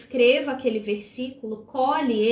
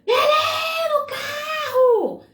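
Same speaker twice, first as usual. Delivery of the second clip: much higher, loud sharp shout; warning yell; 3 dB louder, much slower than usual. Shown as a demonstration. Mother yelling warning at child running into street, loud shout. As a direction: yell to stop the child dead. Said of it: "tu vai dar um berro".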